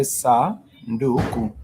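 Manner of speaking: sa, ndu, ku slowly, each syllable apart. Shown as a demonstration. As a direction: sound out sa, ndu, ku for a learner. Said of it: "It has three syllables".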